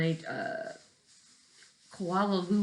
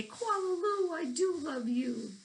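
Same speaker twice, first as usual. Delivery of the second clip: much higher, tender mother voice; loving mother; 12 semitones higher than usual. Acted. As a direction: lift it up sweetly like the mother. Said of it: "She'll throw her arms around her and say".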